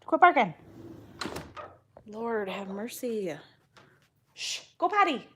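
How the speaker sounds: loud, high scolding calls; yelling at dogs. Real, not acted. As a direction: shout the dogs outside.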